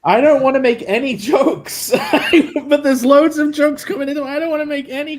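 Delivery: giggling through the words; laughing. Real, not acted. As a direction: laugh while you say it. Laughing while talking.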